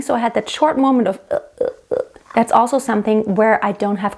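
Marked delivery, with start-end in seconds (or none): 1.3-2.0 breathy broken uh, uh, uh with no clean start; glottal stutter onset. Shown as a demonstration. Teacher showing a bad onset: uh, uh, uh stuttering. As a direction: let the cords catch and stutter. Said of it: "my vocal cords didn't close tightly".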